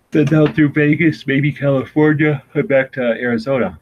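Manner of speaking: talking through a big yawn. Real, not acted. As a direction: stretch the words into a yawn. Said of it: "I'm yawning".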